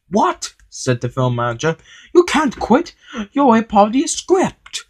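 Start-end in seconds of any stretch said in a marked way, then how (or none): 0.1-0.5 very high disbelieving yelp; shocked. A narrator's reading. Film manager hears Henry quit, shocked. 2.1-4.8 very high-pitched disbelief; alarmed protest. A narrator's reading. Film manager begs Henry not to quit, alarmed.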